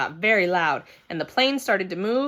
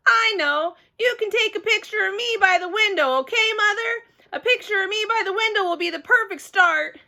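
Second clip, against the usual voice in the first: put-on excited kid; 10 semitones higher than usual. Girl begging mother for a photo, eager. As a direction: bubble over with eagerness.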